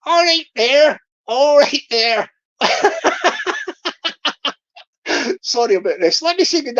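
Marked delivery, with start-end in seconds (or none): 0.1-2.3 put-on Bristol accent. Streamer imitating how a Bristol scammer would greet you, in a Bristol accent. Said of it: "he'll be talking like that". 2.6-4.5 a high, giggly laugh at his own accent. Real, not acted. Streamer laughing at his Bristol impression.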